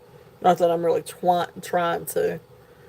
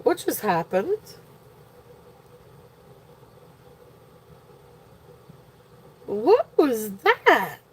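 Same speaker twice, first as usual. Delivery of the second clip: startled; 2 semitones higher, 3 dB quieter, slower than usual. Real, not acted. Startled by a sudden noise.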